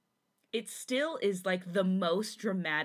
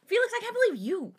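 high, fast mock indignation; mock outrage; 12 semitones higher, 5 dB louder, faster than usual. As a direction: squeal it in hammed-up disbelief. Listener mocks the line, overacting.

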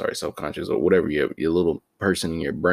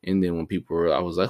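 ordinary talk

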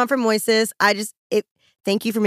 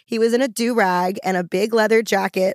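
ordinary delivery

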